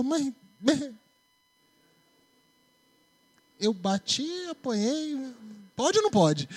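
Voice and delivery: lightly voiced confused kid; confused child. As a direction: play a puzzled child asking mom. Confused child asking mother.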